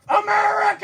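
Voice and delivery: high shouted war cry; battle cry. Retold or imitated. Man sprinting into the Capitol holding skunks, high shouted battle cry. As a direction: charge in yelling it.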